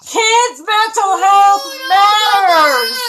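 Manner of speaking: loud, drawn-out rallying cry; mock bullhorn shout. Pretending to shout from her small town roadside, a slow bullhorn shout. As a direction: bellow it down the street like a protester. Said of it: "stand on the side of my road and go".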